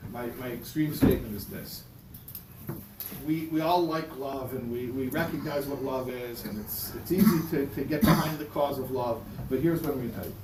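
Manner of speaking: ordinary talk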